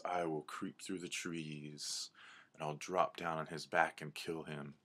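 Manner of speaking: hushed predator's promise; stealthy cougar. Acted. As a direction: lay out the ambush quietly. Cougar volunteers a stealthy attack.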